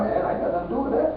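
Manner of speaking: a bystander's hasty soft denial; mock brushoff. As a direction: rattle it off under the breath, fast.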